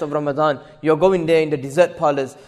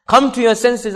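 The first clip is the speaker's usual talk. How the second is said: higher, forceful appeal; raised exhortation; 5 semitones higher, 4 dB louder than usual. Real, not acted. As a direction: urge the congregation with a raised voice. Repeats plea with raised voice.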